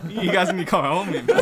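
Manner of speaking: pleading for help through laughter; half-laughing cry for help; laughing plea. Retold or imitated. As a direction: laugh while begging them to come. Calling for rescue, laughing.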